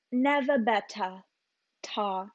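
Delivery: "never better" slowly, as "nevuh bettuh"; dropped R's. Shown as a demonstration. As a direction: leave the R off both "never" and "better". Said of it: "I always ignored the R's".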